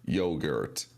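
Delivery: a hint of a low computer voice; Google robot voice. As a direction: read it like a digital assistant. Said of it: "computerized digital voice of Google".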